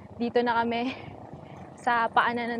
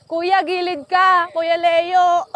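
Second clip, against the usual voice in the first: loud higher call to a friend; 6 semitones higher, 6 dB louder, slower than usual. Real, not acted. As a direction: shout across to him. Calling out to riders on rough road.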